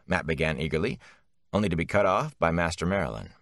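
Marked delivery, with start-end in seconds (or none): none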